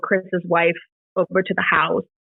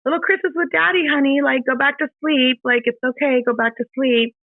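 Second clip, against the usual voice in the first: put-on higher, gentle mother's voice to a sleepy child; soothing a child; 6 semitones higher than usual. Soothing her daughter at night.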